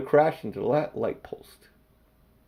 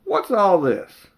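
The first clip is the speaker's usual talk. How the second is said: slow, higher, louder bobby; slow stern policeman; 7 semitones higher, 7 dB louder, slower than usual. Acted. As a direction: drag it out officiously. Policeman questioning.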